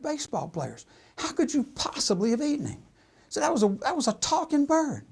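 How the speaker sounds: high-pitched, aghast listing; acted incredulous protest. Pop protesting to Mutt over the eaten bird, incredulous.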